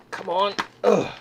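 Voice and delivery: ordinary delivery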